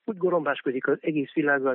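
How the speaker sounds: plain reading voice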